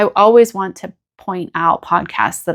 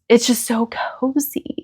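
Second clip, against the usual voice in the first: whispered, soft and dreamy; 4 semitones higher, 4 dB quieter than usual. Real, not acted. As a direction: breathe it out as a hushed aside. Cosy podcast space, repeated softly.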